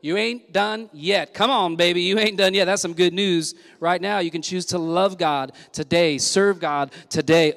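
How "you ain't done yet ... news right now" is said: ordinary talk, nothing marked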